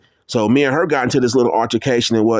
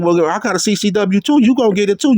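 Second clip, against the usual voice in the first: heated retort; 9 semitones higher than usual. Retold or imitated. Heated phone argument, gun threat.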